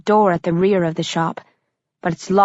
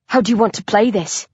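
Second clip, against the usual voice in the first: plain reading voice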